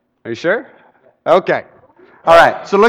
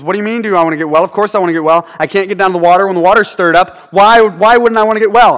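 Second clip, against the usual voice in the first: fast, rising from plain to louder at the end; indignant rush; 4 dB louder, faster than usual. Retold or imitated. Lame man protesting to Jesus, fast and rising. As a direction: rattle it off as if the question were absurd.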